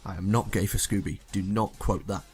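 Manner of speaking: ordinary delivery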